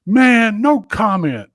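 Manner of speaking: Mike Vick's reply. Retold or imitated. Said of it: "he goes".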